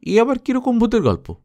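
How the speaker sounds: high-pitched disbelieving schoolboy; incredulous question. A narrator's reading. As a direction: scoff at it as no proper ghost story. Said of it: "Gupi বললে".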